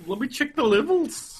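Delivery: high, loud goofy voice; silly voice. Pretend. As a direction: ham up the sound check. Repeats the line in a silly voice.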